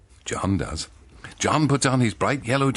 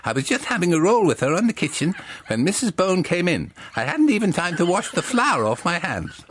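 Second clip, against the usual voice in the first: higher, louder helpful John; 9 semitones higher, 3 dB louder than usual. Acted. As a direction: play the eager shop helper, pitched up. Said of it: "says John".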